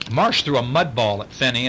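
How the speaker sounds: plain reading voice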